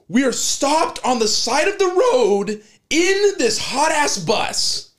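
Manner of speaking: high, loud frustrated yell; mock-furious bus rant; retold yelling. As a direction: shout it at your groggy friend. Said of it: "my friend next to me yelling".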